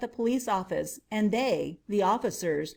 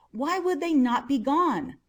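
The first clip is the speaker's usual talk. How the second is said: ordinary delivery, nothing marked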